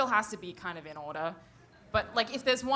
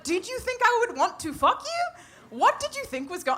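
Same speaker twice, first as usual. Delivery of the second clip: incredulous questioning; 13 semitones higher, 5 dB louder than usual. Acted. Asking the sender what he thought would happen, incredulous. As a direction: demand an explanation in a high, baffled voice. Acting out baffled challenge to sender.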